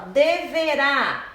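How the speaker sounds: "Deverá" slow, strong on final RÁ; drawn-out "Deverá" peaking on RÁ; stressed syllable. Shown as a demonstration. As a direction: land on the last syllable of "Deverá". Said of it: "última sílaba".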